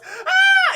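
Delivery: shrieking loud yelp; hammed-up scream as she's blasted; high mock scream. Halle hit by water jet, loud mock scream. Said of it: "she just goes".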